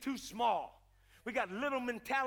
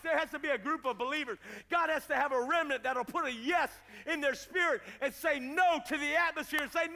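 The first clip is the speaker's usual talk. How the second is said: loud, high sermon building to a long shout; full-force preaching; 4 semitones higher, 6 dB louder than usual. Acted. Preacher rallying the church against the no over the region, full-force preaching. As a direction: pile up each declaration louder and more forceful.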